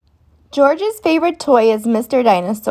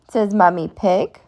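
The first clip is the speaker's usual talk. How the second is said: usual speaking voice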